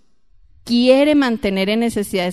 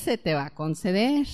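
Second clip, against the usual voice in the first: usual speaking voice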